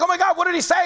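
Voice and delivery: eager boss. Retold or imitated. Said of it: "the boss is like".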